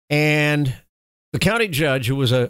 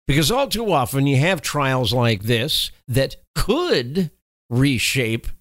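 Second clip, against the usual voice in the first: ordinary talk, nothing marked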